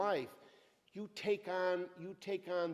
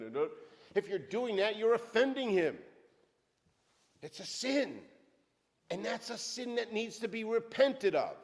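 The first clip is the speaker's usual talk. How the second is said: raised, driving preacher's voice; fiery preaching; 2 semitones higher, 4 dB louder than usual. Acted. Preacher exhorting the church, full-force preaching. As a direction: preach it hard to the congregation.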